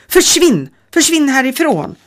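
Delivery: screaming Försvinn at the intruder; shouting. A narrator's reading. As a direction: drive her out with a yell. Woman shouts girl away.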